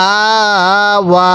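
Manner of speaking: long steady "আ", then long "ওয়া"; stretched vowels. Shown as a demonstration. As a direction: draw out both "আ" and "ওয়া". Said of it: "এরকমভাবে শিখব".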